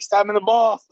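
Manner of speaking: high, loud hyped cheer. Shown as a demonstration. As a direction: demo the cheer for the actor. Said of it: "really hyped up about it".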